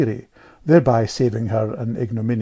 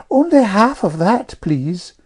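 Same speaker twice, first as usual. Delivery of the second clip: put-on high, louder prim little girl; Amelia's prim request; 9 semitones higher, 5 dB louder than usual. A narrator's reading. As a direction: pipe up politely like a prim child. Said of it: "coughing off the table and saying".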